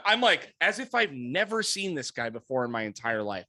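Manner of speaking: ordinary talk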